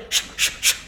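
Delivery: sharp voiceless shushes; three short imitated hushes; imitated shushing. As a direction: mimic a crowd shushing someone. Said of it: "and they".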